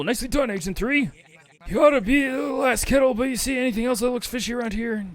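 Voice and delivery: game character voice. Acted. Reading game character dialogue.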